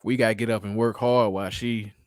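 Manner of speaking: ordinary talk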